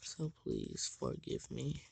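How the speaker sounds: tired, hoarse and raspy throat. Real, not acted. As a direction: croak it out, worn and scratchy. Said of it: "please forgive me for my ultra raspy voice".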